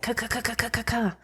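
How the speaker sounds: imitating a heartbeat. Describing the coworker's nervous heart during the hug, soft imitation. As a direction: mimic a nervous racing heart. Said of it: "her heart was like".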